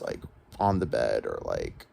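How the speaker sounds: ordinary talk, nothing marked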